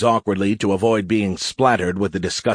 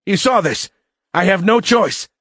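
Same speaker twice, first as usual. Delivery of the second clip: high, frantic cadet voice; panicked appeal; 11 semitones higher, 4 dB louder than usual. A narrator's reading. Cadet turns to his friends for support, panicked. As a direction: plead justification in a high, loud voice.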